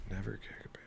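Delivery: whispered under the breath; whispering. Real, not acted. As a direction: whisper it to yourself. Repeats superstition to himself.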